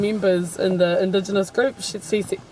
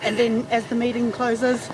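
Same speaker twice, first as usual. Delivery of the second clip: ordinary delivery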